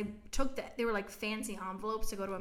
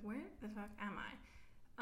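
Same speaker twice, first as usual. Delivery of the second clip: bewildered; 11 dB quieter, slower than usual. Retold or imitated. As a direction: say it under your breath, lost. Retold lost moment, much quieter.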